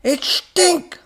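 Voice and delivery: very high, very loud scream; furious scream. A narrator's reading. Professor correcting robot, furious scream. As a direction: scream the name with a sh at full force. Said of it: "screamed the professor".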